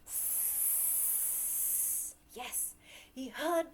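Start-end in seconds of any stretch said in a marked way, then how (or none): 0.1-2.1 "S" as a long soft hiss. Shown as a demonstration. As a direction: hiss along with the kids. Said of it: "Can you make the sound with me".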